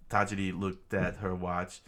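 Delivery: plain reading voice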